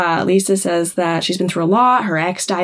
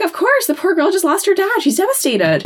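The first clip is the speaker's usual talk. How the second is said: put-on high-pitched, hurried protest on the girl's behalf; mock sympathetic protest; 11 semitones higher than usual. [name] excusing a grieving girl to her mother, high mock protest. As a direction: plead her case high and fast, then settle.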